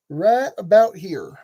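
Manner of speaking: put-on slow, lightly drawled southern accent. Building tutorial, slipping into slow southern drawl. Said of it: "really flying that southern drawl".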